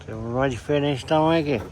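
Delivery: talking around a pencil in the teeth; words muffled by a pencil held in the mouth. Real, not acted. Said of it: "Tô falando assim porque eu tô com lápis na boca".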